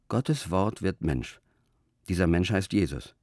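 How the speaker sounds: ordinary talk, nothing marked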